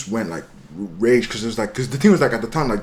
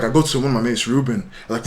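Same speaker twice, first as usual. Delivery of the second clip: normal talking voice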